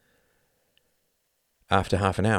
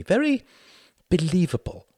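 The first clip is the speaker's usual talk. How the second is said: high-pitched praise through a wan smile; 12 semitones higher, 4 dB louder than usual. A narrator's reading. Wendy praising Tom, wanly smiling. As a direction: pitch lifted, weakly smiling. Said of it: "smiling wanly".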